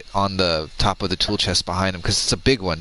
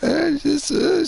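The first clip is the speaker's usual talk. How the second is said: high broken mock sob; imitating mom crying; 5 dB louder, much slower than usual. Speaker imitates his crying mom on the phone, high and broken. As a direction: choke on the words like mom on the phone. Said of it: "She's like".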